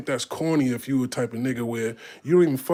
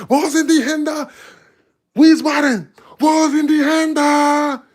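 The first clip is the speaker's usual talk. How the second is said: "Wo sind die Hände?" yelled much higher and louder, slow and drawn out; hype MC call; 12 semitones higher, 9 dB louder, slower than usual. Shown as a demonstration. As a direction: work the German crowd from the stage. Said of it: "That's how you say it in, in Deutsch".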